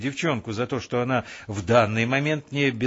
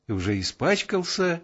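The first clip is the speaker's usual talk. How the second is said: cheerful; 7 semitones higher than usual. A narrator's reading. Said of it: "весело перебила девушка".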